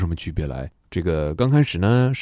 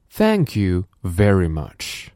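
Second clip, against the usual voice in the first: 1 slow repeat; slower than usual. Shown as a demonstration. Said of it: "Now repeat after me".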